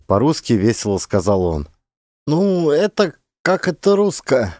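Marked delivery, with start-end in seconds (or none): 2.3-4.5 high, effortful searching for words; a boy groping for Russian words, pitched up; straining boy in broken Russian. A narrator's reading. Boy struggles to explain to the officer, straining. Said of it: "С натугой выдохнул пацан".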